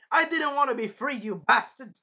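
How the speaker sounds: imitated furious comeback; angry retort. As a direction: fling it back indignantly. Voicing Raphtalia snapping back.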